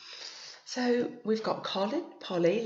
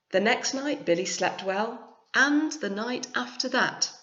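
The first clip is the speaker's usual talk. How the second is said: story narration; 4 semitones higher, 5 dB louder than usual. A narrator's reading. Reads picture book aloud.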